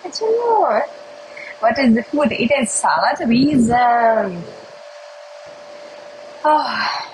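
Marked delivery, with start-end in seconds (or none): 0.0-0.8 high, excited why. Pretend. Reading out a chat request, asking why in a full excited voice, pitched high. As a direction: throw the why up high, all worked up. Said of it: "взволнованным".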